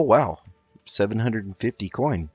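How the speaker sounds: normal talking voice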